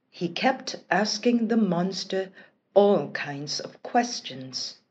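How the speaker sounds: usual speaking voice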